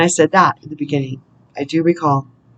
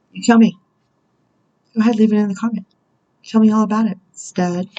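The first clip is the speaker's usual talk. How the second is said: higher-pitched, playful film-character come-on; 5 semitones higher, faster than usual. Acted. Reader channeling a Grease heroine to viewers, higher-pitched imitation. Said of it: "[name] from Grease".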